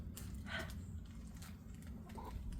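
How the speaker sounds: long, very soft airy breath; deep breath. Acted. Duck calming himself before school, soft long breath. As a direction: draw a slow deep breath. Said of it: "Duck breathed in very deeply".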